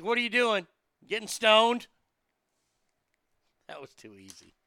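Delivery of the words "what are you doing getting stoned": higher and louder, calling through the door; parents calling out. Retold or imitated. Parents call to their son in his room, loud and high. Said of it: "parents would call to him".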